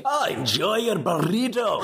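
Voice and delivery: slow, broad Scottish groundskeeper; a hammy Scottish burr; Scottish accent. Acted. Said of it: "terrible Scottish accent read".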